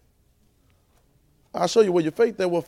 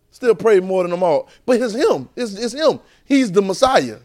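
usual speaking voice

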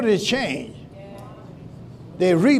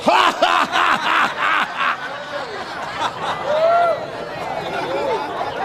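forced laugh; 11 semitones higher than usual. Pretend. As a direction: force a big laugh out on purpose. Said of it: "Force it out".

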